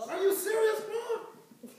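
incredulous king. Acted. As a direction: question Paul as if he's crazy. Voices the king doubting Paul.

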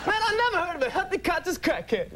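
very high, strident comedian voice; hammed-up high-pitched comic impression; Chris Rock. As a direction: push it high and punchy, stand-up style. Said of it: "have to talk like Chris Rock".

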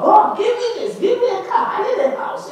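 high, pleading imitation shout; mock shouted prayer. Congregant demanding things from God, mock shouting. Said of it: "yeah, you shout at God".

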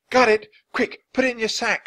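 high triumphant got it; breathless triumph; excited hurry. Acted. Annie returns with book.

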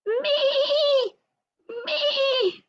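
very high bleating Me; sheep bleating. A narrator's reading. Lamb Lumi crying from the well, high bleat. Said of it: "bleated louder".

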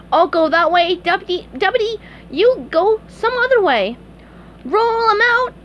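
high, loud cartoon orders; commanding SpongeBob. SpongeBob orders his deputy another way, commanding.

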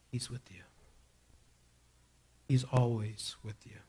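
soft whispered assurance. Acted. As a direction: whisper it like a secret comfort. Whispered reassurance in sermon.

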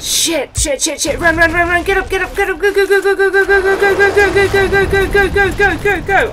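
high frantic urging; panicked fleeing chant; being chased. Real, not acted.

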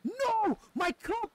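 loud, high dismayed yell; shouting in dismay. Real, not acted. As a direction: scream at the loss. Loses cup to shark.

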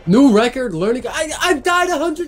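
exasperated yell. Real, not acted. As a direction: wail it out in despair. Exasperated gamer after many deaths.

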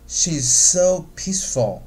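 slow, with "so peaceful" stretched out; stress on peaceful. Shown as a demonstration. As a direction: draw out the vowels in "so peaceful". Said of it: "就是音拉得很长".

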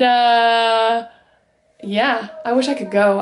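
ordinary delivery

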